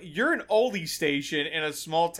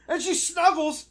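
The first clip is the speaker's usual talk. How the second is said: normal talking voice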